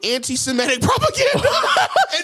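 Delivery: rising, laughing through the words; laughing rant. Real, not acted. Laughing at his own joke to his co-host. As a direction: crack up mid-rant. Laughing while ranting about propaganda.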